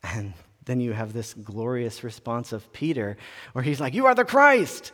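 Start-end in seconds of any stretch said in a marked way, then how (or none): 3.9-4.9 sudden high loud outburst; blurting it out. Retold or imitated. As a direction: blurt it out on impulse, higher and louder. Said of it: "blurting it out".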